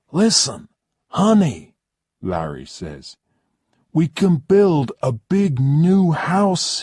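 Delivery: lightly voiced eager American husband; coaxing husband. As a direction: sell her on the big new house. Larry soothes his wife.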